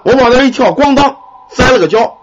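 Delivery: plain reading voice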